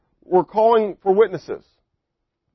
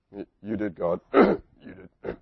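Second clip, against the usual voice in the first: much lower, much softer stammer; cowed Moses; 10 semitones lower, 8 dB quieter than usual. Acted. Moses answering God after a rebuke, meek and soft. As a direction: shrink away, barely getting the words out.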